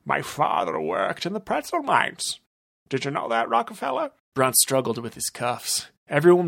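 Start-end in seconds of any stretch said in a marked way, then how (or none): 0.0-4.1 higher villain voice, plain volume. Acted. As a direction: say it as the villain normally would. First take, normal villain.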